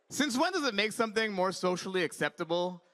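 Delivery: usual speaking voice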